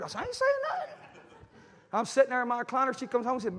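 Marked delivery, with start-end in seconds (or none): 0.2-0.9 very high squeaky denial; mock innocence. Father promising his daughter to keep quiet, mock innocent.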